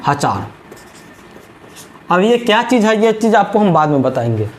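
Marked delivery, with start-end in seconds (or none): 0.1-0.5 "हजार" as ha-chaar, low falling answer; memory-trick pronunciation. Shown as a demonstration. Teacher answering own question, low "हजार" said as ha-chaar. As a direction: answer with "हजार" pronounced ha-chaar, dropping in pitch. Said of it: "क्या पढ़ना है?".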